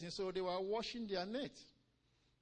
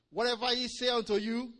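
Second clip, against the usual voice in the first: louder, higher preaching cry; proclaimed instruction; 5 semitones higher, 7 dB louder than usual. Retold or imitated. Preacher quoting the instruction to believers, proclaimed loudly. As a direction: ring it out to the congregation.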